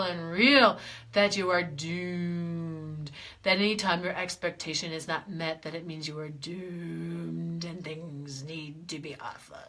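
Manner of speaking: slow, low, sinking doom voice; lightly voiced gloomy doom. Coach mocking the belief of doom, low and slow. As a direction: drag it down low like certain doom. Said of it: "how I have fun with my voice".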